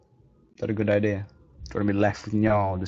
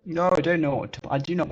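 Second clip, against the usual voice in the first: flustered; 7 semitones higher, 4 dB louder than usual. Real, not acted.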